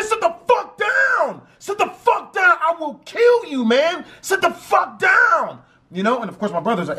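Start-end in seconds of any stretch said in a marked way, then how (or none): 0.0-5.6 high, furious shouted threat; gunman's yell. Retold or imitated. Gunman screaming at the brother to sit down. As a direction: bellow it like a gunman losing control.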